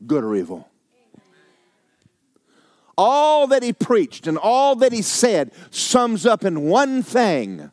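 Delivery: high, loud, ringing pulpit delivery; full-force preaching. Acted. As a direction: preach it to the back row.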